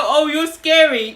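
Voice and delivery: loud, high, drawn-out mimicry; men's mock alarm. Men telling her she is scary, mock alarm.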